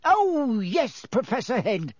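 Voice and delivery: high, delighted, relishing the secret; gleeful poltergeist. A narrator's reading. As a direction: savour the bombshell you're about to drop. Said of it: "cradling a large bombshell".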